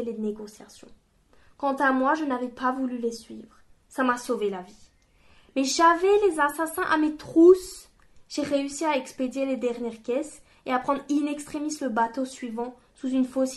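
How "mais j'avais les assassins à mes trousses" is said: raised urgent voice; tense, urgent. A narrator's reading. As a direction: push it out, hunted and urgent. Kenner recalls the hunt.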